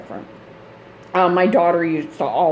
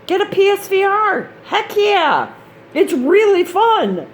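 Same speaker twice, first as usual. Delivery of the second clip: excited pitch; 11 semitones higher than usual. Real, not acted. Enthusing about PSVR.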